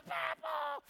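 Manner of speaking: put-on high child's shout of Papa; mock little girl yelling for Papa; child's cry for Papa. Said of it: "I hear one girl go".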